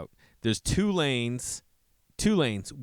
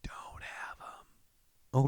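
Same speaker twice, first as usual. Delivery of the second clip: whisper; 8 dB quieter, much slower than usual. Real, not acted. Mumbled aside, unvoiced.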